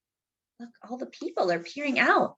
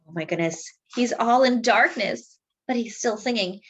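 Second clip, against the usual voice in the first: ordinary delivery, nothing marked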